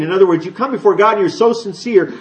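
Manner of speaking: normal talking voice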